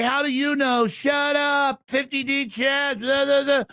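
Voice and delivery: high mocking shout of a critic; mock heckler voice. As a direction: sneer back what the haters say. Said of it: "people wanna say".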